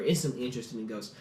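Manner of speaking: usual speaking voice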